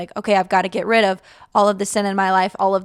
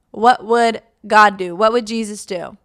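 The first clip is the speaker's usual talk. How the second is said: ordinary delivery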